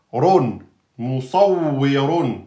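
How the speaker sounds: "moussawwirun" very slow, doubled w; slow demo reading; slow model. Shown as a demonstration. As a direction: stretch out "run, moussawwirun". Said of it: "entre deux emphatiques".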